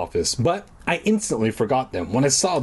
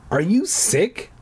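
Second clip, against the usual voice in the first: cried out; 6 semitones higher, slower than usual. A narrator's reading. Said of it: "I cried".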